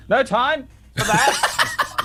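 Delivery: high, slow, loud falcon voice; Nimble Chan. Acted. Falcon flying above cuts off questions, high and impatient. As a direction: call down high and drawn out, impatient. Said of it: "Nimble Chan flying above".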